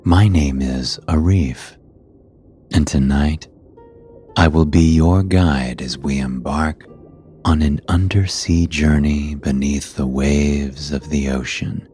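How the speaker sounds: plain reading voice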